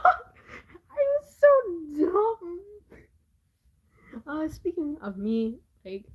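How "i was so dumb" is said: laughing. Real, not acted. Retelling childhood confusion.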